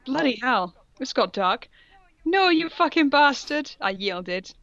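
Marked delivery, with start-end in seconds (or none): none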